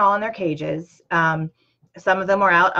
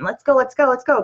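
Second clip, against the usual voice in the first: higher, louder mock panic, child begging to leave; imitating panicked daughter; 6 semitones higher, 4 dB louder than usual. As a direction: act out a scared kid tugging to get out. Scared daughter pulling mom out.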